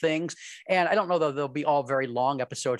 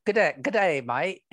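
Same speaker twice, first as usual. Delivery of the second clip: failed Aussie accent; 3 semitones higher than usual. Shown as a demonstration. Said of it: "Uh, no".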